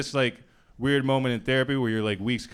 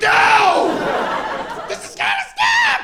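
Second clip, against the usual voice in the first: much higher, louder anguished yell; outraged shout; 10 dB louder, much slower than usual. Retold or imitated. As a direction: scream it in mock outrage, slowly. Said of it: "He's like".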